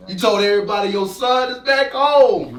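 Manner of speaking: joyful shout. Acted. Son telling his father about the homecoming, shouting joyfully. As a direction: shout it with pride and joy.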